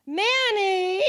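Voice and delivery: put-on a loud Ohio-accented yell of "Manny!"; Chillicothe Ohio accent call. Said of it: "Chillicothe, Ohio accent".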